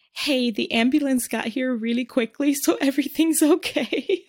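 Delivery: forced-casual news with a chuckle breaking in; nervous laugh. Retold or imitated. Peter telling Debbie over the phone, laughing nervously. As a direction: laugh it off as if nothing's wrong. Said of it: "because he says".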